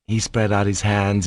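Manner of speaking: ordinary delivery, nothing marked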